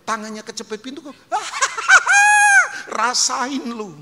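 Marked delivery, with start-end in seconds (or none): 1.2-3.0 a sudden, very high, loud burst of mocking laughter; loud jeering laugh. Mocking someone whose hand is pinched. 3.0-4.0 mocking. Mocking someone whose hand is pinched.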